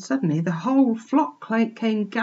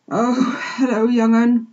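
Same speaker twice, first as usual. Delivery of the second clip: higher-voiced rustic old sheep; puffing old sheep; 4 semitones higher, 4 dB louder than usual. A narrator's reading. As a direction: greet the youngster like an old country ewe. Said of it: "puffed the old sheep".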